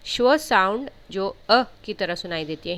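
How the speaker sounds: normal talking voice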